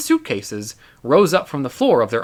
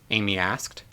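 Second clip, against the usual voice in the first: usual speaking voice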